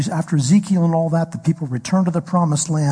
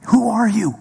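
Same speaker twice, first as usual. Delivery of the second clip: startled question; 6 semitones higher than usual. Retold or imitated. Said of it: "Same question Moses asks at the bush".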